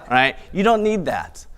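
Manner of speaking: ordinary delivery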